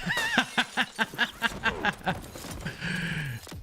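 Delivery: drawn-out laughing fit; long laugh. Real, not acted. Laughs at what happened.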